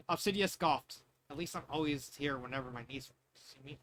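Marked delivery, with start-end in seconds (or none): none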